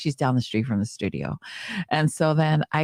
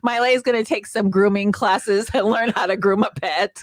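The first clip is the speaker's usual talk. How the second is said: defiant retort; 6 semitones higher than usual. Retold or imitated. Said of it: "the lady says".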